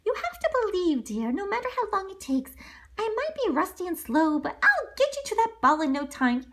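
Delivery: put-on high, kindly old lady voice; fairy godmother encouraging Cinderella. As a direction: encourage her sweetly.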